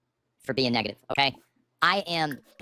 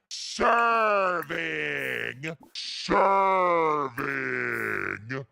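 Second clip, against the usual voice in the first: dragging out; much slower than usual. Real, not acted. Drawn-out repeated word.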